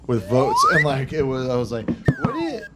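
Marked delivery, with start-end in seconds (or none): 2.2-2.6 incredulous squeak. Retold or imitated. Retold exasperated reply.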